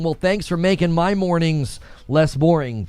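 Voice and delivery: usual speaking voice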